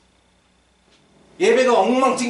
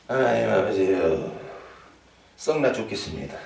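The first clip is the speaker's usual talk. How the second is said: sulky prayer; 12 semitones lower, 5 dB quieter than usual. Retold or imitated. Angry member praying.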